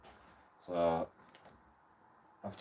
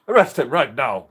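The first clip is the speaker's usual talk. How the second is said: mock demand; 5 semitones higher, 12 dB louder than usual. Retelling demand to police.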